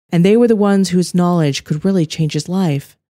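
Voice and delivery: ordinary delivery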